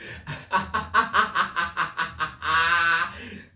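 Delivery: long staccato cackle; real laughing fit. Real, not acted. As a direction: crack up at your own joke. Cracks up at own punchline.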